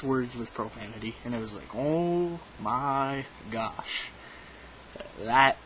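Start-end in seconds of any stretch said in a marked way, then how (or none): 1.7-4.1 slow, drawn-out oh my gosh in disbelief; stunned oh my gosh. Retold or imitated. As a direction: stretch each word out, dumbfounded. Shocked by the man's profanity.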